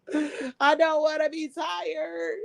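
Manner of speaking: high, slow, whiny fake cry; mock whine. Streamer whining to chat about work tomorrow, mock crying. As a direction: whimper it like a tired kid. Repeats line, then whines it.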